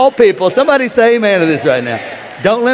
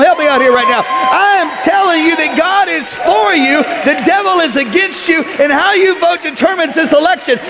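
high, loud preacher shout; full-force preaching; 11 semitones higher, 4 dB louder than usual. Acted. Preacher to West Palm, shouting at full force. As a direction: preach it all the way up.

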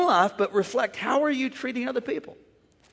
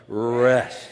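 "Rest" stretched long and firm; slow, strong, drawn-out "Rest"; 9 semitones lower than usual. Shown as a demonstration. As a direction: draw out the word "Rest" with force. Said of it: "shout it real slow and strong".

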